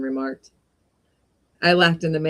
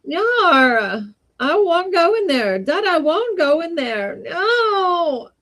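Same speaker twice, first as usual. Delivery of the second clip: put-on high, squeaky wailing child refusing; a small spoiled boy howling that he won't go in, very high; squeaking crying child; 12 semitones higher, 3 dB louder, slower than usual. Spoiled boy at the door wailing to his dad, high squeaky crying. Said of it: "a squeaking little voice could be faintly heard".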